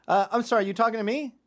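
high, loud mock-innocent question; feigned confusion. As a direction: butt in as if confused. Pretends confusion to torment cabbie.

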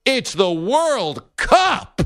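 high, very drawn-out exclamation; big exclamation. Acted. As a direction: stretch it out as a huge punchline. Punchline of the football analogy.

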